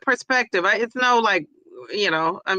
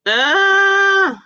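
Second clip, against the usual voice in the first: startled cry; 11 semitones higher, 6 dB louder than usual. Real, not acted. Sudden loud cry while waiting.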